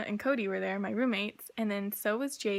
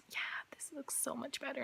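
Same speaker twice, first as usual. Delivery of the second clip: hushed, patronizing "oh sweetie" voice set higher; condescending whisper; 5 semitones higher, 11 dB quieter than usual. Retold or imitated. Stepmoms dismissing her worries about the cabinets, patronizing whisper. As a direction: talk down to her in a breathy hush.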